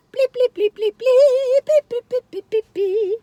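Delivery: squeaky high bleep bleep; high robotic beeping. Acted. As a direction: beep like a little machine, high. Silly beeping noises.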